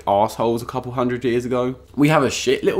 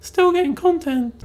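very high mock falsetto; imitated happy viewer; slower than usual. Viewer enjoying the other channel's videos, mock falsetto delight. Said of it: "and been like".

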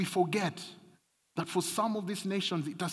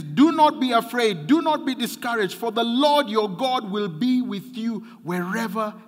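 loud, higher preacher's proclamation; preaching; 7 semitones higher, 7 dB louder than usual. Acted. Pastor proclaiming God's command to the church, loud preaching. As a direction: thunder the promise to the people.

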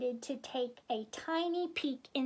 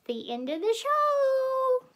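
very high, loud, sing-out finish; showy sign-off; 12 semitones higher, 7 dB louder than usual. Real, not acted. Host closing the story hour, singing out. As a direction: belt it out, stretching the last word. Reader signs off playfully.

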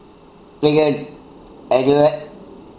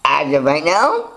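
normal talking voice

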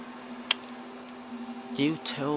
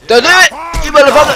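screaming; 13 dB louder, much faster than usual. Real, not acted. Screaming at game event.

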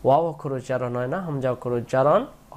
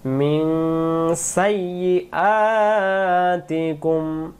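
slow melodic tajweed chanting; slow chanted Quranic recitation; much slower than usual. Shown as a demonstration. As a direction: draw the vowels out in a sung recitation. Recites verse demo.

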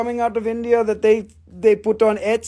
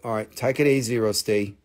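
low, quieter put-on voice; self-calming; 10 semitones lower, 4 dB quieter than usual. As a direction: bring it right down low. Calms himself after the rant.

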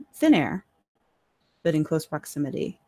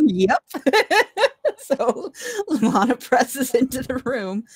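real laughter through the words, higher pitched; laughing; 8 semitones higher, 6 dB louder, slower than usual. Real, not acted. Game master moving Lana into the room, laughing. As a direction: narrate while still cracking up.

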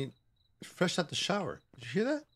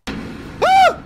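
sharp high scream of fright; startled scream; 11 dB louder than usual. Real, not acted. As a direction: shriek at the jump scare.

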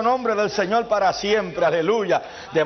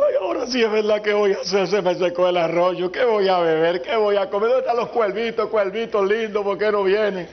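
mock lament. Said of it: "No se quedó allí llorando".

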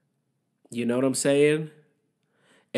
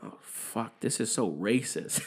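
dawning realization; 7 dB quieter than usual. Retold or imitated. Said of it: "and he was like".

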